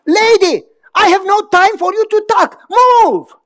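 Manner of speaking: high, loud shout building to the last word; angry yell. Retold or imitated. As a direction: yell at the lady to move it. Yelling at driver in booth.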